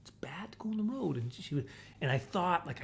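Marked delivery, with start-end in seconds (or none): 0.0-1.2 hushed, gentle telling-off fading to a whisper; soft scolding. Retold or imitated. Owner petting the dog, softly warning it off the road. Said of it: "I was petting her, and I was like".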